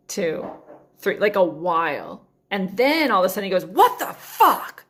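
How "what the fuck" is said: high, slow, drawn-out furious outburst; mad yell. Retold or imitated. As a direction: play the angry passenger three rows back. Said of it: "like, mad".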